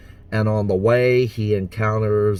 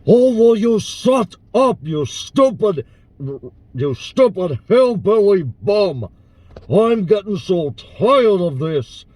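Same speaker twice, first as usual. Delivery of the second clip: put-on angry Russian-accented shouting, pitched high; Dippity; 8 semitones higher, 4 dB louder than usual. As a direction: scream in exasperation with a thick Russian accent. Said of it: "classify it as a Russian accent".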